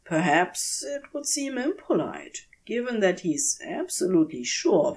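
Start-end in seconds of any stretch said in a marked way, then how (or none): none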